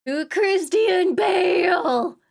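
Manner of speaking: high, loud, drawn-out exclamation; playful shout. Pretend. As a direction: stretch the name out in a mock holler. Playful outburst at the name.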